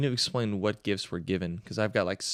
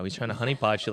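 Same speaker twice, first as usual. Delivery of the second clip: ordinary delivery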